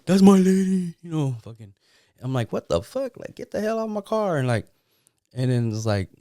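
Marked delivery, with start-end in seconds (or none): 0.1-1.0 imitating a jealous guy's shout. 2.5-4.4 mock outraged yell, much higher. Retelling his own reaction.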